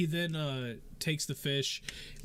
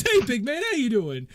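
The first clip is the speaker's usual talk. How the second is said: high, loud mock-casual greeting; friendly NPC; 9 semitones higher, 9 dB louder than usual. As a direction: greet the big guy brightly from the barrel. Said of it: "He goes".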